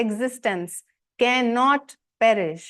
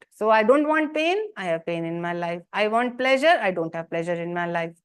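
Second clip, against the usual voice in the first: usual speaking voice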